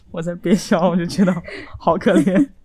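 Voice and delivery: words pushed through held-back giggles; trying not to laugh. Real, not acted. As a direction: hold in the laughter and barely manage.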